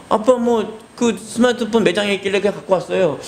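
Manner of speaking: put-on high childish voice; child's voice, casual; innocent child. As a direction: answer like a kid who sees no wrong.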